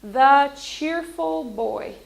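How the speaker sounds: slow, loud and bright teacher example; cheerful example. Shown as a demonstration. Teacher modelling a cheerful phrase, slow and loud. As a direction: say it slowly and cheerfully for the class. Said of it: "adjectives are words that describe nouns".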